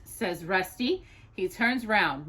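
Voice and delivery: plain reading voice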